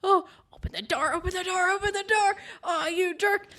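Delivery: high, frantic pleading, locked in a room; hammed-up panicked friend banging to get out; mock panicked yelling. Friend locked in during a hunt, frantic high pleading. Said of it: "she's, like, yelling at me".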